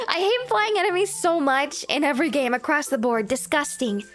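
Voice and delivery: ordinary talk, nothing marked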